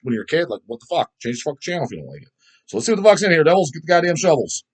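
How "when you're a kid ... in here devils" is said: ordinary talk, nothing marked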